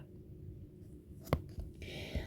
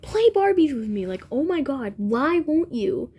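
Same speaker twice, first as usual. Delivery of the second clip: higher, exasperated imitation of a pestering friend; mock pleading; 4 semitones higher than usual. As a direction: draw out the plea, mock-dramatic. Friend pestering her in a retold quarrel.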